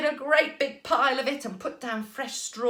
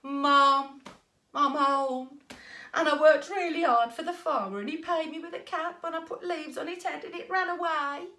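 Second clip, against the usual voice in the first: droning, higher dejected whine; hammed-up sorry-for-himself boy; 5 semitones higher than usual.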